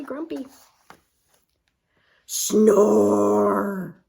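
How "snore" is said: loud snore sound. Acted. Sound effect of snoring.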